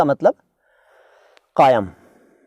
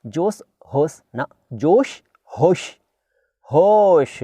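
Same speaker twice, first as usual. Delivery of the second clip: slow drawn out; 3 semitones higher, much slower than usual. Shown as a demonstration. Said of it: "जोश में होश नहीं खोना मुन्ना".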